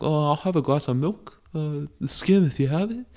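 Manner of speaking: high, put-on polite voice; imagined restaurant customer. Customer ordering milk in a restaurant, mock-polite high voice.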